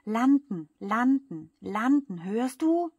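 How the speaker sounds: loud, high, repeated demand; urgent yell climbing to the final question. A narrator's reading. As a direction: shout each word like a pounding fist. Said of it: "hämmert mit den Fäusten ... und schreit".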